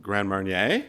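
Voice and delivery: questioning French attempt with a rising end; mom's best French accent. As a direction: imitate a tourist trying out French, hopefully. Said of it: "in her best French accent".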